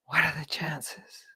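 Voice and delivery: soft, slow whispered repeat; whispered echo. Retold or imitated. Listener echoing the punchline to herself, whispered. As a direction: breathe it under the laughter. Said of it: "listener echoing the line".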